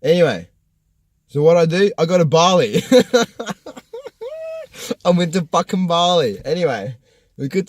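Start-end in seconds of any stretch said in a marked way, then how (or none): 2.9-5.0 high-pitched laugh; long, high peal of laughter. Real, not acted. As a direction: burst out laughing at yourself. Laughs at own Bali decision.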